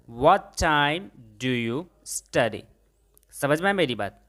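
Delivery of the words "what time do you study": very slow, word by word; slow model sentence. Shown as a demonstration. Teacher shows students the English, very slowly. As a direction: spell it out slowly for learners. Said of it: "आप कितने बजे पढ़ते हैं?".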